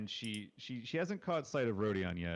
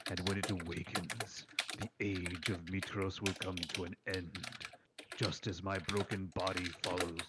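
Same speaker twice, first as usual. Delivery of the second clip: deep rumbling voice. Acted. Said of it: "you hear a deep rumbling voice say".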